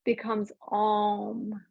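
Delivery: slow, drawn-out "om". Shown as a demonstration. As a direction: let the "om" stretch out slowly. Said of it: "slowing down of that ka sound".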